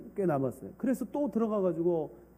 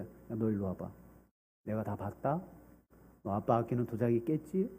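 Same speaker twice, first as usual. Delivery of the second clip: low, softer threatening man; sly neighbor; 7 semitones lower, 8 dB quieter than usual. Acted. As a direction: lean in low and menacing to the child.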